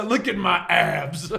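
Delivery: slow, mock-proud flex; a hint of a vain villain; lightly voiced Bane. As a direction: show off the abs, slowly. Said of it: "Bane's just like".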